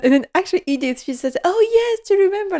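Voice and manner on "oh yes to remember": high, loud mock enthusiasm; eager exclamation. Dinner guest trying to save the conversation, loud high eagerness. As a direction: burst in much higher and louder, imitating eager agreement.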